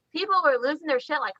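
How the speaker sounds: ordinary talk, nothing marked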